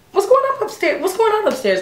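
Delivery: very high singsong questioning; put-on nosy sweetness; feigned innocent curiosity. Woman probing her date about the crowd upstairs, falsely innocent.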